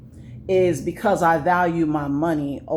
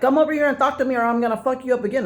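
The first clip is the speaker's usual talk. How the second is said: imitating rival's threat; 8 semitones higher, much faster than usual. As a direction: play the small man yelling threats. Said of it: "He was like".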